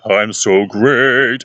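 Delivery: slow, drawn-out, controlled voice at normal pitch; measured, sustained mock performer voice; improved performer. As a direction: imitate a polished performer, stretching each word. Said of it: "And you see another thing like".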